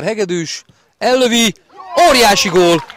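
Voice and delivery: loud shouted goal call; excited hungarian sports commentary. Real, not acted.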